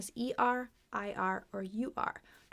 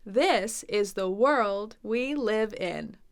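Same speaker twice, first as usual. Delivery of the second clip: very slow, each word separately articulated; over-enunciate it slowly like an English teacher; clear teacher speech; 3 dB louder, much slower than usual. Shown as a demonstration. American English teacher speaking so clearly, slow and careful. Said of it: "talk so clearly like American English teachers".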